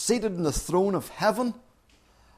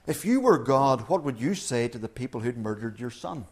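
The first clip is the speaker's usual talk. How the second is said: normal talking voice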